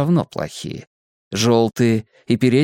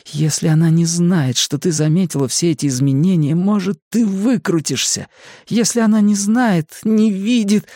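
higher, urgent frightened thought; shrieking inner panic; 6 semitones higher than usual. A narrator's reading. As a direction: plead with yourself, climbing higher as panic builds. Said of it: "а часть рассудка верещала". (внутренняя паника, всё выше)

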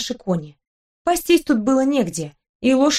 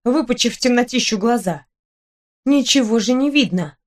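ordinary delivery, nothing marked

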